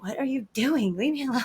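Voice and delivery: retold annoyed protest, pitch climbing; playful exasperation. Acting out annoyed reply to fawning husband.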